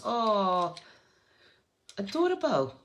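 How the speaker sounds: high, loud adoring exclamation; gushing delight. Real, not acted. Crafter reacting to a viewer's tissue box, gushing delight. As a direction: gush with delight, pitch leaping up.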